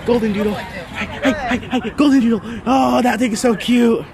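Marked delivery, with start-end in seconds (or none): none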